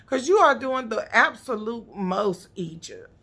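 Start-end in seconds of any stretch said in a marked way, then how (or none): none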